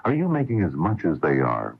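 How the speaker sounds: ordinary talk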